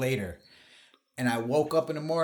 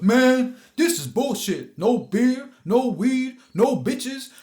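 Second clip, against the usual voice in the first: high, loud griping; Doug complaining; 10 semitones higher, 7 dB louder than usual. Retold or imitated. Doug griping in the car, mock annoyed and loud. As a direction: whine about the bad night.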